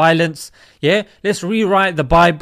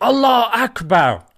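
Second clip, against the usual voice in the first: mock shout; 4 semitones higher than usual. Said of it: "who shouted".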